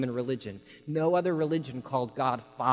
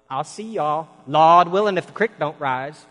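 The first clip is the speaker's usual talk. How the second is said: mamaw's Appalachian sayings; 3 semitones higher, 10 dB louder than usual. Retold or imitated. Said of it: "she would say".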